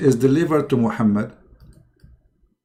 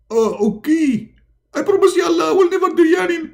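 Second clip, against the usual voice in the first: very high, rushed promise; eager Muhammad. Acted. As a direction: gush out the promise.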